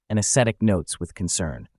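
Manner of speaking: plain reading voice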